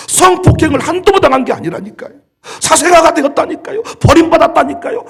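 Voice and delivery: shouted sermon climax; fiery preaching. Acted. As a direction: preach it with full fervor. Preaching at full force.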